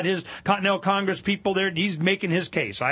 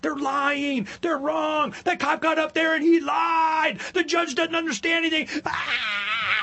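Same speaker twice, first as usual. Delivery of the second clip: mock frustrated rant; 10 semitones higher, slower than usual. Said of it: "they freak out".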